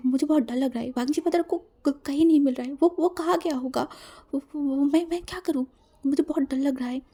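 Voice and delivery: helpless, fearful pleading on the phone; frightened, panicky. Acted. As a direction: stumble over words in worried panic. Frightened sibling on phone, stammering.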